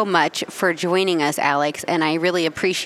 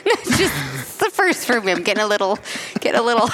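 breaking into giggles; laughing through the words; stifled giggles; 3 semitones higher, faster than usual. Real, not acted. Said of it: "I'm trying not to laugh right now".

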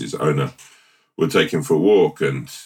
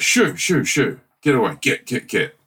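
shooing the cat; 9 semitones higher than usual. Acted. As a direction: shoo the cat off the garden. Owner chasing the cat away.

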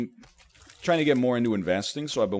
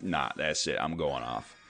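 mock deadpan outrage; 9 semitones lower, 8 dB quieter than usual. Imitating a touchy poster, low and soft. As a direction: lower and much softer, flat fake fury. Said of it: "and they're like".

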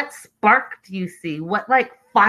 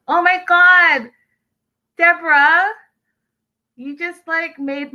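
gushing; 9 semitones higher, 5 dB louder than usual. Real, not acted. Host touched by a viewer's love story, gushing. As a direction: gush with a big, stretched-out exclamation.

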